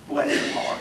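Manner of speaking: whispered Bless her heart; a hushed heart blessing. Shown as a demonstration. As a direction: breathe it after the pause. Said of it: "put that little pause in there".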